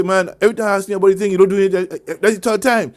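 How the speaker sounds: loud, fast imitated nag; nagging. Spouse complains about an undone repair, imitated loud nagging. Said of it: "There's a difference".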